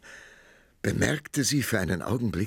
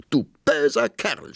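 loud high cry of outrage; shout; 8 semitones higher, 5 dB louder than usual. A narrator's reading. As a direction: yell it at him, stung. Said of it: "schrie sie".

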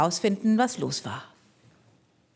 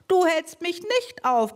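mock bellow; 8 semitones higher than usual. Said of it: "brüllte er".

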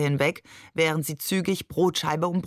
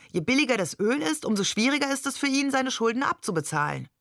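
higher and fast, indignant lecturing; worked up; 7 semitones higher, faster than usual. A narrator's reading. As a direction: rattle it off, getting heated. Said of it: "echauffierte sich Barbe". (aufgebracht, höher und schnell)

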